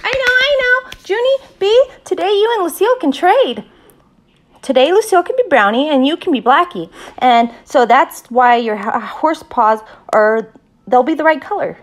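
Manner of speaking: high, loud burst of eager excitement; bubbling over, high-pitched and loud; very excited. A narrator's reading. Grace pitches her idea to Junie B., very excited. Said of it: "together very excitedly".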